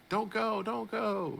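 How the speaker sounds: high, tiny pleading; pleading rabbit voice. Retold or imitated. Rabbit begging the man to stay, high plea. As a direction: beg in a small squeaky voice. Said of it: "the rabbit's like".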